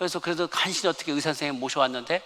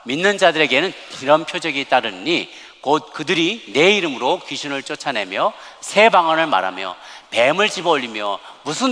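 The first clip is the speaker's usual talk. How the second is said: scripture read aloud; 6 dB louder than usual. Acted. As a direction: lead the congregation through the verse, measured and full-voiced. Leads congregation in scripture reading.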